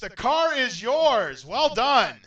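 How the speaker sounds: screaming high and loud with joy; over-the-top host jubilation; ecstatic shout. Real, not acted. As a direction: blow the roof off celebrating the win. Contestant wins the car.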